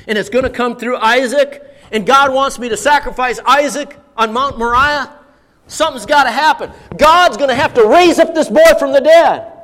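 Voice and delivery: building to a loud, high conclusion; impassioned reasoning, rising to a shout; Abraham reasoning urgently. Acted. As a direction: think it through aloud with mounting conviction. Acting Abraham reasoning toward faith.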